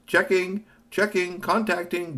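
high, beeping device announcement; machine voice. Acted. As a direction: read it like a communicator booting up.

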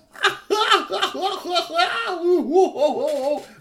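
long, high, loud mocking cackle; high cackling laugh. Curtis cackling at his friend tied to the branch, high and loud. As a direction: cackle at the friend stuck in the tree.

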